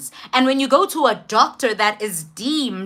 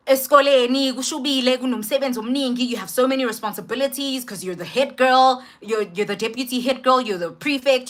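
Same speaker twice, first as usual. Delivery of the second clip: ordinary talk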